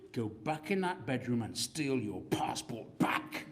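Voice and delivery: hushed whispered urging. Retold or imitated. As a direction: whisper it like a secret order. Urging her secretly.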